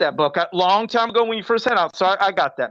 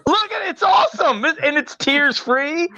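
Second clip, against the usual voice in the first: high, bright product hype; excited sales pitch; 7 semitones higher than usual. Pretend. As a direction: sell it like a TV ad. Hyping up a bath product.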